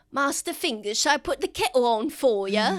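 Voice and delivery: lightly voiced Smee-like servant; bumbling old servant. Old groundskeeper to Fingers, a hint of bumbling servant. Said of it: "he's like".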